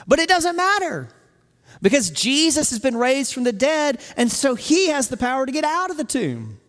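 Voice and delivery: high, loud pulpit storytelling; impassioned preaching. Real, not acted. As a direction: preach it with rising force. Preaches the tomb story forcefully.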